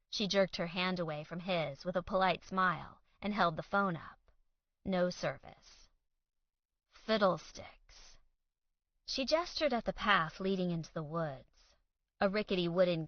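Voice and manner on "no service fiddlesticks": usual speaking voice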